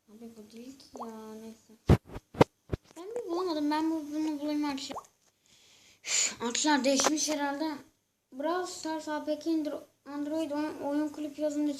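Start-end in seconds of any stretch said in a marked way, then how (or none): 3.0-7.8 higher and louder exasperated complaint; real annoyed outburst; frustrated outburst. Real, not acted. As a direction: vent the frustration out loud. Frustrated he can't find it.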